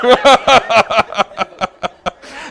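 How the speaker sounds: high, loud burst of laughter; long cackle that tails off; helpless laugh. Real, not acted. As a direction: crack up at your own story. Laughs at own story.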